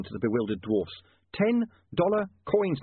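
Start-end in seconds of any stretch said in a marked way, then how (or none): 1.3-2.8 "Ten dollar coins" high, slow, word by word; emphatic clarifying. A narrator's reading. Newspaperman correcting the dwarfs' misunderstanding, stressing "Ten dollar coins".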